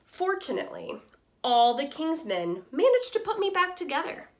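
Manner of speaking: lively storybook reading voice for a class; reading to children. Acted. Teacher reading Humpty Dumpty's story to students, lively storytelling voice. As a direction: read it like a picture book to young kids. Teacher reads picture book aloud.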